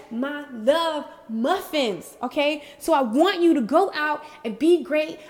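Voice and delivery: ordinary delivery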